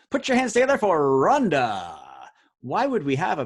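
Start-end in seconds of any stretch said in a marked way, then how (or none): 0.1-2.0 loud, booming game show introduction; game show shout. Acted. Quiz host hyping the clue Rhonda to the crowd, booming. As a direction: bellow it like a host bringing a star on stage.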